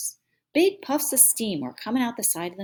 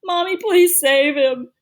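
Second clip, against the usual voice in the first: put-on high, loud childish wail; blubbering; 10 semitones higher, 6 dB louder than usual. A narrator's reading. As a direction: blubber it out, pleading with Mommy. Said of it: "Joey blubbered out".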